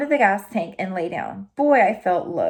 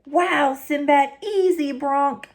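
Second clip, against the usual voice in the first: pitched-up yell at the dog, then soothing the colt; cowboy yelling; 5 semitones higher, slower than usual. Acted. Slim on bucking colt yells at Hank, then soothes. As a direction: shout him off, then calm the horse. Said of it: "he started yelling".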